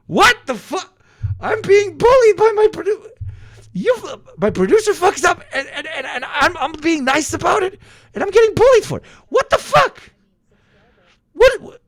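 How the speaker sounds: very high, loud squealed outrage; indignant shouting. Real, not acted. Exaggerated outrage at producer's bullying.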